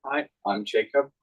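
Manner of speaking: ordinary talk, nothing marked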